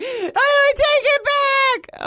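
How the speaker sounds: high, slow, loud wail; hammed-up desperate plea to unsay it; mock panic. Retold wish to unsay words.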